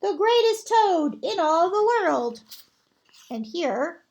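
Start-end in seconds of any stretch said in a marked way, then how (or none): 0.0-2.4 higher, louder announcer calling from far off; strange announcer voice. Acted. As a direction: present him like a grand showman. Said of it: "a strange voice from far away said".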